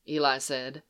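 ordinary delivery

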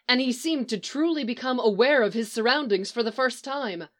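usual speaking voice

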